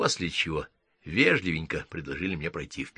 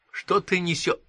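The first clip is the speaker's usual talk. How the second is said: outraged interruption; 12 semitones higher than usual. Acted. Sun cuts him off, outraged.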